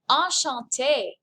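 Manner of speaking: Enchanté drawn out slowly with a falling close; savoured enchanté. Shown as a demonstration. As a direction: linger lovingly over Enchanté. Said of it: "J'adore cette phrase".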